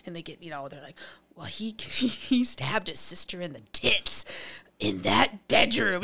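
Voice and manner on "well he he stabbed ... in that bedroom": slow, ominous voice; spooky storyteller. Retold or imitated. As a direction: creep the kids out.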